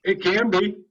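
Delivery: talking around a sour candy; words through a mouthful of candy; eating sour candy. Real, not acted. With sour candies in his mouth.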